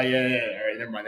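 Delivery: ordinary talk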